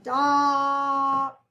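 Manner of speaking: "doctor" drawn out slowly as DOC ... tor; split into syllables. Shown as a demonstration. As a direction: stretch "doctor" into two separate syllables, holding the first vowel. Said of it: "we have a K sound for that C".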